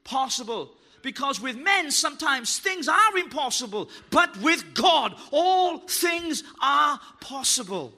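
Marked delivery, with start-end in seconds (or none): 4.1-7.9 slow, loud proclamation; every word shouted out slowly; preaching shout. Real, not acted. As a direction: drive it home to the congregation. Preacher's climax.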